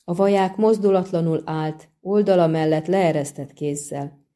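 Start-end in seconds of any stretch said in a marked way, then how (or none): none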